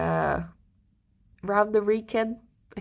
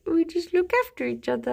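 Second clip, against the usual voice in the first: quoting him well higher; gentle boyfriend; 9 semitones higher than usual. Retold or imitated. Alex reassures her he cares, higher gentle voice. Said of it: "He was like".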